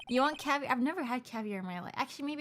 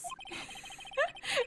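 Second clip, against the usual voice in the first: high giggle; 3 dB quieter than usual. Real, not acted. Laughing at own judge voice.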